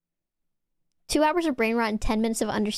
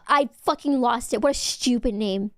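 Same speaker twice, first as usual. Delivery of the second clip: ordinary talk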